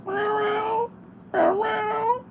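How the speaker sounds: very high soft meows; double meow. Retold or imitated. Copying the cat's double meow outside, very high. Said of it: "he'll do this double meow".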